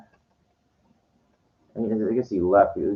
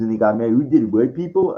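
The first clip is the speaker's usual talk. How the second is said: loud, higher-pitched challenge; raised voice imitating an opponent; heated mimicked retort; 4 semitones higher, 6 dB louder than usual. Mimics the man arguing back.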